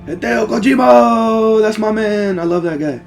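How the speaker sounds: normal talking voice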